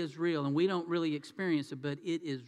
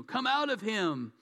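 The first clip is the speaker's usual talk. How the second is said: slow, higher-pitched mock exorcism command; stern command; 4 semitones higher than usual. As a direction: act out ordering a demon out, drawn out. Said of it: "and says sternly".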